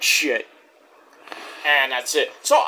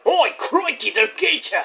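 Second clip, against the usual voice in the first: high, hammed-up Aussie accent; put-on Australian accent, pitched up; mock Australian accent; 8 semitones higher than usual. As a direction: exclaim like a cartoon Australian. Mimicking Australians after Crocodile Dundee mention.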